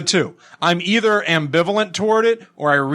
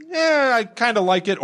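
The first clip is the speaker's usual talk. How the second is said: high self-quote; 8 semitones higher than usual. Retold or imitated. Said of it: "I'm either".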